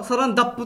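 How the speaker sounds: サランダップ with ダ in place of ラ; mom's mispronunciation. Retold or imitated. Son imitating how his mom says Saran Wrap: ダ for ラ. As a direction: say サランダップ, the ラ turned to ダ. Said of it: "って言うんですね".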